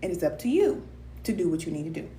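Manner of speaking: usual speaking voice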